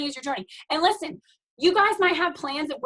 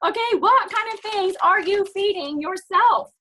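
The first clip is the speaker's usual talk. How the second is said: normal talking voice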